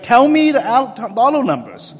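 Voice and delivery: high, loud insistent demand; pushy demand. Retold or imitated. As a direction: get in his face for numbers. Ali's face up close demanding.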